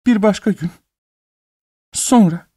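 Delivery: high, whimpering moan. A narrator's reading. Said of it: "diye inledi".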